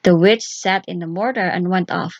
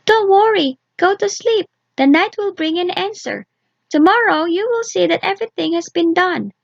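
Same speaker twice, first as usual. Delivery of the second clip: comforting doll; 10 semitones higher than usual. Acted. Doll soothing Vasilisa to sleep, high and gentle. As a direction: hush her fears in a tiny high voice.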